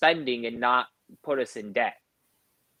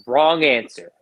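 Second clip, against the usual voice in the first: put-on louder, flat verdict from the older brother; mock stern correction; 8 dB louder, slower than usual. Brother catching him out, louder mock stern verdict. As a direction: slap down the wrong answer.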